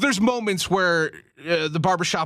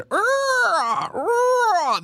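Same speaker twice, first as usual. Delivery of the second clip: high, drawn-out mock dinosaur screech; dinosaur shriek impression. Host mimicking the horror shrieking for his co-host, high mock screech. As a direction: shriek it like a raptor in the movie. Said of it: "that was my Jurassic Park impression".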